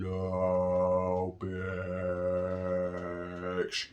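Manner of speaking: low, soft, long-drawn slow-motion voice. Retold or imitated. Inmate watching the man walk over, slow-motion refusal. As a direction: stretch each word as in slow motion. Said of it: "he's actually slow motion like this".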